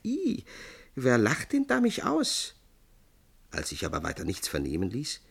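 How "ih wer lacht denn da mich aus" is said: high-pitched startled squeak and question; etwas erschreckt. A narrator's reading. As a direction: cry out in mild fright, pitched high. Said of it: "rief etwas erschreckt". (hoch und etwas erschreckt ausgerufen)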